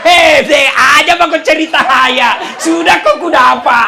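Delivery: loud, laughing mock outburst; hammed-up yell at the spouse; a spouse shouts back during a quarrel, laughing; mock angry shout.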